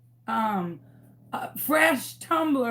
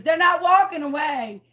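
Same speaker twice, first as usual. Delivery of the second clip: retold yell; 4 semitones higher, 5 dB louder than usual. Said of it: "I'm screaming at my neighbors".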